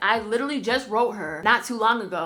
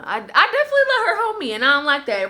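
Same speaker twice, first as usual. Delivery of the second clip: much higher and louder, half-laughing at herself; exasperated self-scolding; 6 semitones higher, 6 dB louder than usual. Real, not acted. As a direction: own up to it with a loud cringe. Admits she let friend walk over her.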